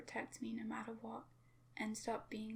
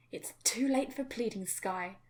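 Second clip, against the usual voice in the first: loud, menacing shout at his daughter; furious yell; 9 dB louder than usual. Acted. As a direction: yell her down without mercy. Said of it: "he yelled back".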